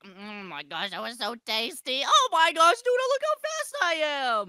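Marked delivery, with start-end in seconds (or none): 2.0-4.5 loud, very high excited burst; thrilled squeal. Pretend. Player showing a friend their new speed, thrilled squeal. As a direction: shriek it out in excitement. Energy drink makes him fast.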